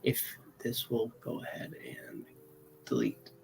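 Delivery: whisper. Real, not acted. Aside while deleting something.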